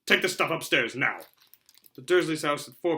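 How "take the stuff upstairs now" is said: curt order. A narrator's reading. Uncle snapping orders.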